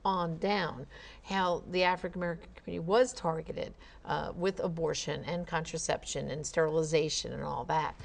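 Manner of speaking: ordinary talk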